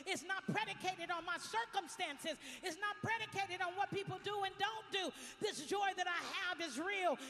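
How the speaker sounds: builds to a loud, high, ringing proclamation; rising joyful praise. Retold or imitated. Pastor voicing Marion's jubilant praise, building loud.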